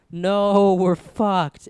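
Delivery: loud, high cry of doom; retold dismay. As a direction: cry out as if replaying the moment you got caught. Retold dismay at being caught.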